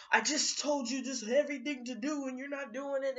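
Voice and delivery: imitated exasperated telling-off; mock yelling. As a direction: scold like a fed-up parent yelling, hammed up. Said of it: "they're yelling at me like".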